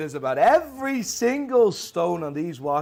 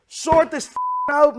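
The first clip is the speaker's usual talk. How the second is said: loud, high, drawn-out order; furious demand to get it fixed; angry demand; 10 semitones higher, 6 dB louder, slower than usual. Real, not acted. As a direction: yell it slowly in exasperation. Demanding bank fix the problem.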